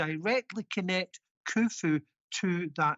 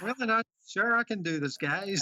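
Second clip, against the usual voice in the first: higher-pitched put-on American accent; best American accent; 4 semitones higher than usual. Guest reprising the bit for the hosts, mock American accent. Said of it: "your best American accent".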